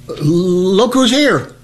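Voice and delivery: slow, halting stutter; stuttered slowly. Retold or imitated. Nervous recruit challenges rustling bushes, halting stutter. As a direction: trip over the words nervously. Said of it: "And I stuttered a little bit".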